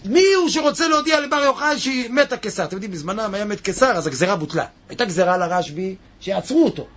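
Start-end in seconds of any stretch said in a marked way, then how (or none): 0.0-2.6 mock shouting outside. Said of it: "רק צעק בחוץ".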